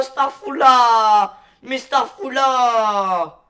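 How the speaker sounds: loud, drawn-out call across the room. Real, not acted. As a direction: shout his friend's name slowly. Calling out to his friend.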